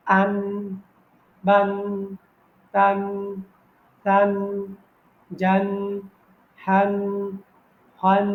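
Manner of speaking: আন, বান, তান said as whole clipped syllables, the nunation ending each; আন বান তান as fluent Quranic endings; fluent recitation. Shown as a demonstration. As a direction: recite just the sounds, no spelling. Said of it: "শিখার পরে আপনি পড়বেন".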